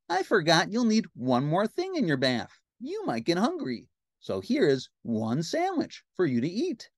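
high-pitched helpful cartoon pal; Ernie. Ernie offers food for the bath, high cartoon voice. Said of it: "Ernie said".

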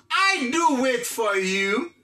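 slow, careful English line; slow model phrase. Shown as a demonstration. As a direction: lay each word out slowly. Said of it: "teacher models English phrase". (fraz anglè dousman)

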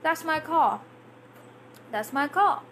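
"car" ending on an open vowel, no R; R left silent. Shown as a demonstration. Teacher shows why learners miss words, "car" without the R. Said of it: "not pronouncing the R's".

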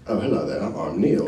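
soft, low, lightly voiced polite author; Neil Gaiman. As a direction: introduce yourself gently, imitating a modest writer. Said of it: "Neil leans over and he goes".

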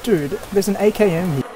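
thrilled loud discovery; excited shout. Real, not acted. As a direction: call out the find excitedly. Finds rare gun, shouts.

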